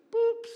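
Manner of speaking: high imitated beep. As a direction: squeak the word like a beep. Beep sound word.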